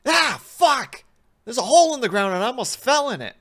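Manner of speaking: high, very loud panicked shout; startled yell. Acted. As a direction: yell as if you nearly fell. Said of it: "the subject yells".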